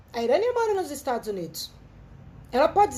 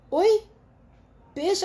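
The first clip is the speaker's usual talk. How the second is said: confused; 6 semitones higher than usual. Retold or imitated. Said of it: "Eu falei".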